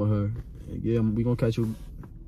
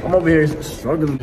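exasperated; 6 semitones higher, 9 dB louder than usual. Real, not acted. Frustrated at machine.

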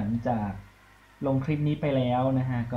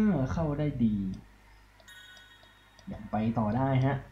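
ordinary delivery

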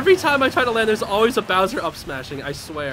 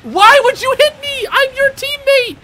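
much higher, louder, whining complaint; whiny high yell; 12 semitones higher, 9 dB louder than usual. Real, not acted. Gamer whining at teammate hitting him, high wail. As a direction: wail it like you've been betrayed.

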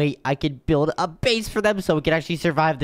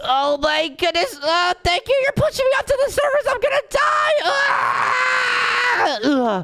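put-on very high, shouting baby crying for help; high panicked baby voice. Stranded baby shouting to strangers for rescue, high panicked baby voice. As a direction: squeal for rescue like a tiny drowning baby.

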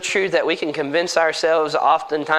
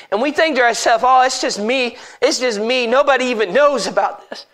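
raised, higher-pitched sermon voice; impassioned preaching; 9 semitones higher, 3 dB louder than usual. Real, not acted. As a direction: press the warning on the congregation.

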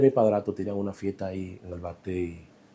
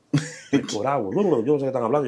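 ordinary talk, nothing marked

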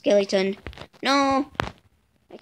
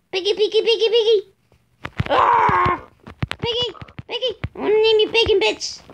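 high piggy call; 10 semitones higher, 3 dB louder, faster than usual. Real, not acted. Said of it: "My voice is cracking".

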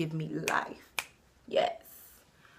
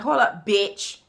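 retold snap; 2 semitones higher, 9 dB louder, slower than usual. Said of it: "So I'm like".

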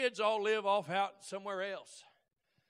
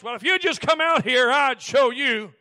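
hollered taunt; 2 semitones higher, 17 dB louder than usual. Retold or imitated. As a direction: call him out from the road. Porch fight taunt acted out.

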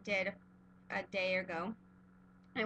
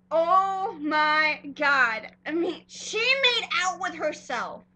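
loud, drawn-out oh my God; can't believe she did it; loud, slow astonished outburst; excited disbelief; 4 semitones higher, 7 dB louder, slower than usual. Real, not acted. Excited reaction to the kiss.

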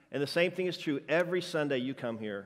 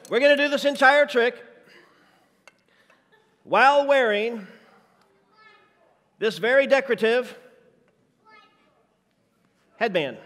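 a lightly voiced pastor, much higher and louder, hyped up; hint of Fred's stage voice, higher and louder; impression of Fred; 8 semitones higher, 8 dB louder than usual. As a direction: play the excited pastor, pitched way up. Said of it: "my juggling impression of Fred Staten".

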